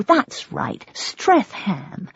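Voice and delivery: usual speaking voice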